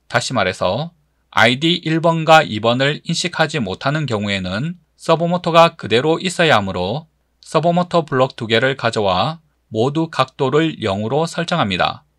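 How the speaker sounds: ordinary delivery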